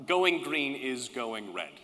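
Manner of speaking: slow, deliberate slogan repeat; firm, slow restatement; slow emphatic slogan. Real, not acted. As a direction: hammer the slogan home slowly. Slogan repeated after false start.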